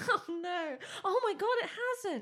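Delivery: laughing disbelief. Real, not acted. Reacting to polls that did not move, laughing. Shocked nothing changed in polls.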